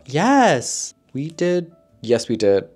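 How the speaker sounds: bright burst of yes; excited. Real, not acted. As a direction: exclaim it happily. Excited yes, recalling book tag.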